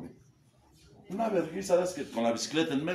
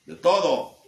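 proud shout; 8 semitones higher, 12 dB louder, slower than usual. Real, not acted. Remata su grito.

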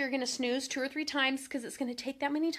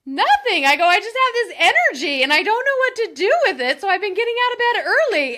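much louder and higher, bursting with amusement; laughing reply; 7 semitones higher, 13 dB louder than usual. Retold or imitated. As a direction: blurt it out while laughing it off. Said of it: "I just started laughing".

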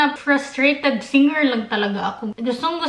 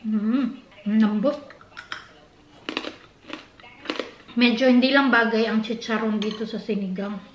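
speaking between bites; talking while chewing; 4 semitones lower than usual. Real, not acted. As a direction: mouth partly full. Seen 0: Spoons soup and food into mouth, chews between words throughout.

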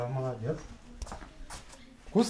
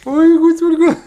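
squealing playful ой; 7 dB louder than usual. Pretend. Dad playing with toddler.

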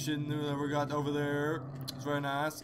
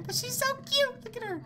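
very high, squeaky cooing; high-pitched fawning over something adorable; cutesy squeal. Real, not acted. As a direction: gush in a tiny high voice. Cooing over cute ghost girl.

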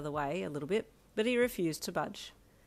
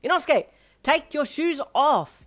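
high, loud scolding shout; exasperated bellowed order; 9 semitones higher, 11 dB louder than usual. Acted. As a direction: yell it, stretching the words. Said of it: "you shouted at him".

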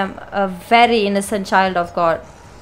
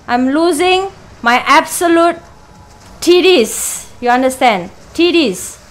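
usual speaking voice

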